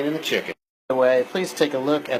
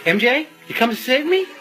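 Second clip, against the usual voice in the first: excited; 10 semitones higher than usual. Real, not acted. Greeting the [name] song.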